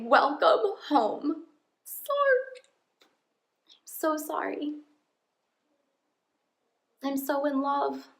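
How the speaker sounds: real choked-up, high voice; overcome, fighting back tears between long pauses; emotional. Real, not acted. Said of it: "get to the end of a video and get emotional".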